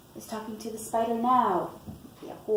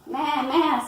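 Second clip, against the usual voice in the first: higher, loud goat bleat; goat's bleat; 7 semitones higher, 5 dB louder than usual. A narrator's reading. Reader voices the goat in a picture book, bleating. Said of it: "said the goat".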